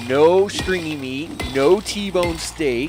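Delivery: drawn-out, mock-sulking list; slow complaint. Real, not acted. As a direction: drag out each missing item. Complaining about missing loot.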